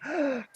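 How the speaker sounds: high sharp shocked gasp; nymphs gasping. Retold or imitated. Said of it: "all the nymphs are like".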